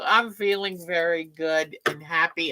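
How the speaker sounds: ordinary delivery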